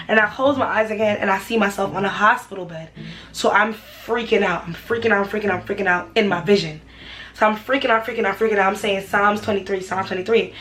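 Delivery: ordinary talk, nothing marked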